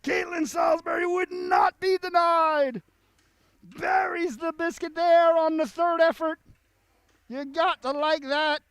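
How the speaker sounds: high, loud shouting play-by-play; excited goal call. Real, not acted. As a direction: scream it as the puck goes in. Goal scored, commentator erupts.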